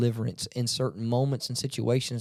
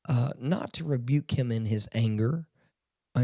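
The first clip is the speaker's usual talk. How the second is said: ordinary delivery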